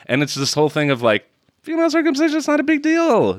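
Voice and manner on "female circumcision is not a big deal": high, put-on breezy voice; sarcastically making light of it; mock dismissiveness. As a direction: brush it off in a mocking high pitch. Mocking the film's breezy message.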